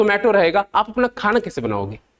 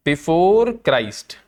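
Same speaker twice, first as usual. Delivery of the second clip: drawn-out words, pitched a little lower; slow dictation; 4 semitones lower, much slower than usual. Real, not acted. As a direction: stretch each word out for the class. दोहराकर धीरे से लिखवाना. (खींच-खींच कर, धीरे-धीरे लिखवाते हुए)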